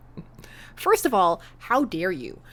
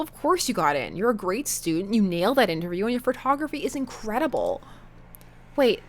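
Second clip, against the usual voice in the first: usual speaking voice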